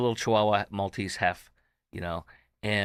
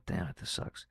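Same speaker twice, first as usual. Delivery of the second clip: much softer, grieving whisper; upset whisper; 11 dB quieter than usual. Real, not acted. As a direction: mutter the grief under your breath. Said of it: "I'm getting a little emotional".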